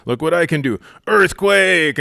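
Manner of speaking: loud, high booming-out boast of a pretend giant; playful giant. Small boy playing giant over an anthill, mock-mighty shout. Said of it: "I'm a giant looking down at this anthill".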